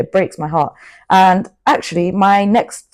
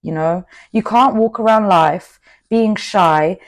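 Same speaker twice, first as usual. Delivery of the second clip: ordinary delivery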